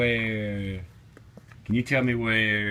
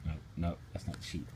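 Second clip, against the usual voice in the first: much softer, lower no; gentle correction; 8 semitones lower, 15 dB quieter, much faster than usual. Real, not acted. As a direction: soften it to a near hush.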